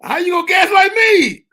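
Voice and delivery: high, indignant shout; loud, high-pitched mock accusation; mock outrage. Mock outrage inside acted quarrel.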